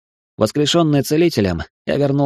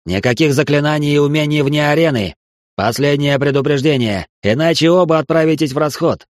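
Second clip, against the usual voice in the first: high, loud furious threat; furious warning; 4 semitones higher, 3 dB louder than usual. Acted. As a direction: shout the final warning. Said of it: "багровея от ярости".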